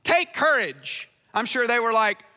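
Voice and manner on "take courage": imitated call, higher and louder at the start; casual calling out. Jesus calls out to the frightened followers in the boat, casual raised call. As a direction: call out reassuringly, voice lifted. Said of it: "just casually calls out to them".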